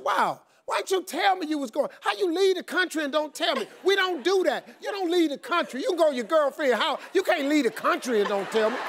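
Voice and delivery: high, indignant husband's rant; letting her have it. Retold or imitated. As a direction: scold in exaggerated disbelief, pitched high. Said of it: "I was letting her have it".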